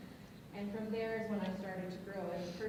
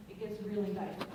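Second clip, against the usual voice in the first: usual speaking voice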